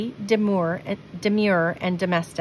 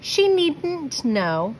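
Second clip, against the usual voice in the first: put-on slow, higher girl's voice; a light hint of a defiant girl; lightly voiced girl; 8 semitones higher, much slower than usual. A narrator's reading. Louisa's reply in story.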